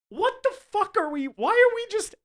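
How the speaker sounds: high, loud, baffled outburst; exasperated disbelief. Acted. Host reacting to the cartoonish chase, incredulous. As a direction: break off as if too baffled to finish.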